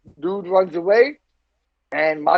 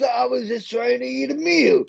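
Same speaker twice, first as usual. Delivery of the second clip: higher, a light hint of the wrestler's voice; Macho Man voice; 6 semitones higher than usual. Macho Man telling the newspaper his side, lightly imitated. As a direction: lightly play the aggrieved wrestler. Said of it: "think of that in his voice".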